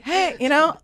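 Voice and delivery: high-pitched wheedling hey; trying to be nice. Retold or imitated. Inmate calls to the guard through food hole, nicely. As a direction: lift it high to sound harmless. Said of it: "I'm trying to be nice at this point".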